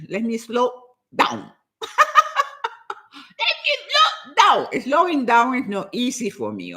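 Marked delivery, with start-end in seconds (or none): 1.8-2.7 high-pitched laugh. Real, not acted. Speaker amused at her own rushing, high laugh. Laughs at telling herself to slow down. 3.4-4.8 much higher, laughing self-mockery; amused squeal. Real, not acted. Laughing repeat of own self-reminder.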